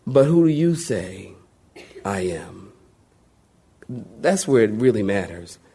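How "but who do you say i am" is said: solemn divine question. Acted. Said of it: "God will look at every single one of us".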